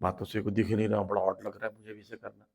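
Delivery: low, soft self-conscious aside. Acted. Woman swayed by fashion talks to herself, low and hushed. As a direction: drop low and fade away as if thinking aloud. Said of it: "बोले".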